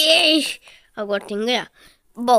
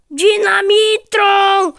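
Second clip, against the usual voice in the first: high, loud, slow call; dramatic cry; 7 semitones higher, 7 dB louder, much slower than usual. Real, not acted. Gamer crying out Dinamitron in play, slow loud wail.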